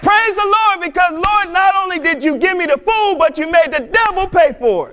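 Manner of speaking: high, loud crowing triumph; triumphant praise. Retold or imitated. Old lady answering the atheist, triumphant shout.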